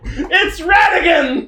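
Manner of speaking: high, drawn-out cry; mock outraged correction. Co-host correcting the Randall nickname, mock outraged cry. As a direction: protest theatrically, stretching the name. Indignant villain correction in song bit.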